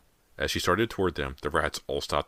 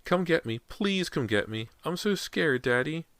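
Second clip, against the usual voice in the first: put-on scared child; 12 semitones higher than usual. A narrator's reading. Child voice pleading.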